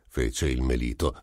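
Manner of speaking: ordinary delivery, nothing marked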